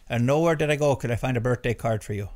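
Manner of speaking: put-on emphatic Minnesota accent; put-on Minnesota errand story. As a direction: tell it like a chatty Minnesotan. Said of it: "the Minnesota voice there".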